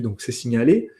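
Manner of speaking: normal talking voice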